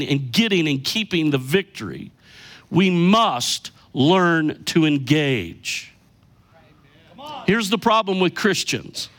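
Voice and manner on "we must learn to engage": very slow, heavy stress on "must" and "engage"; emphatic. Real, not acted. Preacher urging the congregation to engage, emphatic. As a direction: spell out "must learn to engage" word by word. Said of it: "I wanna say this emphatically".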